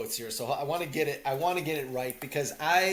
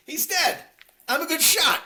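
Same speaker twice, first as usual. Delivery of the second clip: high, loud tough guy; hammed-up loud gangster; mock Tommy; 14 semitones higher, 6 dB louder than usual. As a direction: shout it proudly. Quoting Tommy after the killing.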